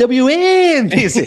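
high, drawn-out call letters; hammed-up, slow radio pronunciation; exaggerated movie-scene imitation.

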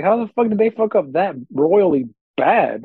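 venting at the team's collapse; retold exasperation.

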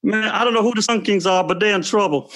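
Barkley impression. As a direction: bellow it like Charles Barkley on TV. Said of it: "I can do Barkley".

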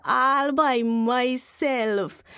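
drawn out, very slow; slow boast. Acted. Husband boasting to his wife, slowly. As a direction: stretch out the proud boast. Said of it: "He said".

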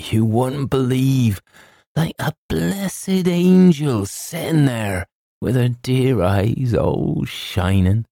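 high, slow, cooing servant's voice; gushing housekeeper. A narrator's reading. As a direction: coo it out high and drawn-out, full of adoration. Housekeeper gushes about the girl.

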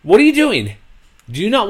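high, loud disbelief; what are you even doing; exasperated. Real, not acted. Exasperated at coach's comparison.

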